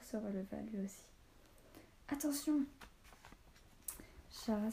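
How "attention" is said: high, urgent call; higher-pitched alarm shout; warning cry. A narrator's reading. Sudden warning cry in battle.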